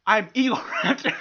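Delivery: grand name through a laugh; breaking into laughter; laughing mock boast. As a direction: announce yourself and crack up. Pompous self-introduction, laughing.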